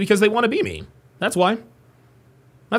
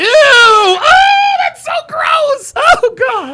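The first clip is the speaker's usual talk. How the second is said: shrieking in disgust; high, loud grossed-out cry; 7 dB louder, much slower than usual. Real, not acted. Disgusted reaction to crude line.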